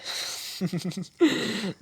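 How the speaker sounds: high, unhinged giggle; unhinged laugh. Acted. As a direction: break into a high mad laugh. Laughs while vowing revenge. (पागलपन भरी ऊँची हँसी)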